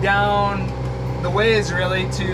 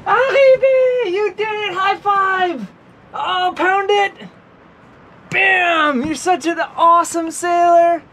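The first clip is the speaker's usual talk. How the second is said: much higher, louder, joyful praise; excited cheering; 9 semitones higher, 4 dB louder than usual. Real, not acted. Mom cheering her toddler for arriving at anchor, excited high shouts. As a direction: cheer her on like she just won a race.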